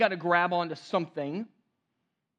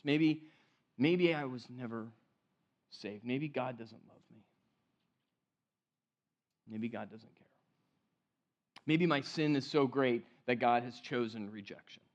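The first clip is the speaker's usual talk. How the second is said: much softer and lower, hopeless musing; quiet self-doubt; 6 semitones lower, 5 dB quieter than usual. Retold or imitated. Preacher voicing a believer's fear of rejection, soft and low. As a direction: murmur the doubts as if to yourself.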